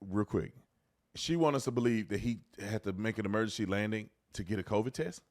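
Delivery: normal talking voice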